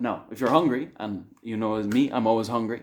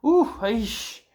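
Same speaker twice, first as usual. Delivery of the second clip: high, louder groan of exasperation; pitched-up exasperated "aish"; exasperated sigh; 12 semitones higher, 4 dB louder than usual. Retold or imitated. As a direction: react as if hearing something stressful. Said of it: "you're just thinking".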